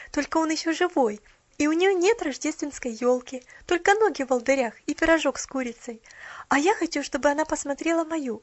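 high, loud breathless chatter; rapid chatter. A narrator's reading. Girl explaining to Mrs Carew, breathless. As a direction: chatter on without a pause. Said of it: "затараторила она".

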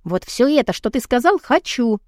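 high, urgent outburst; eager pleading. A narrator's reading. Woman agreeing to Adam's proposal, high eager pleading. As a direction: beg eagerly, voice lifted high. Aroused woman begging her lover.

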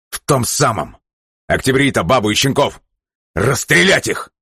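high, savage command; furious order. Acted. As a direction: spit out the order in rage. Джерри яростно приказывает о расстреле. (яростный приказ)

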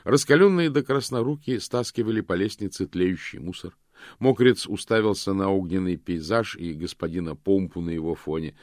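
ordinary delivery

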